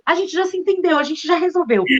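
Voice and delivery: put-on high, far childish voice; mimicking children reporting they made up; imitating the kids. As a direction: chirp it like little kids proudly done. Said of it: "aí daqui a pouco eles".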